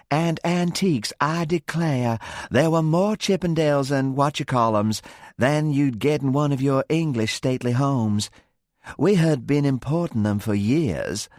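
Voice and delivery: put-on American Southern accent, fond and lilting. A narrator's reading. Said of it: "becoming Southern in accent".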